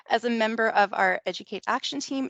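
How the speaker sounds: usual speaking voice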